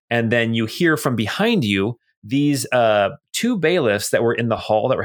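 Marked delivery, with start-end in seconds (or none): none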